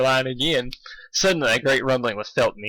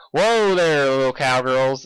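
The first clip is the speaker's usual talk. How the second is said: cowgirl call; 5 dB louder, slower than usual. Acted. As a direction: call out to calm the herd. Applejack calming cows.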